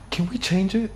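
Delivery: high, soft imitation of a child; little nephew. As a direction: ask timidly like a small kid. Small nephew asks during movie.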